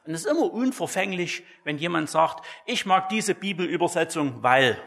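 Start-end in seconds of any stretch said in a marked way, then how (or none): none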